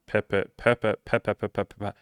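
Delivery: stuck repeating perpe- on a word he can't get out; stumbling over a word. Shown as a demonstration. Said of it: "That's a hard word to say".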